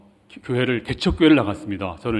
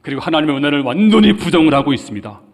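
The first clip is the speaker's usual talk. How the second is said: full-force preaching; 3 semitones higher, 5 dB louder than usual. Acted. Preacher rebuking God's people for their ingratitude, fervent and loud. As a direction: drive it home from the pulpit.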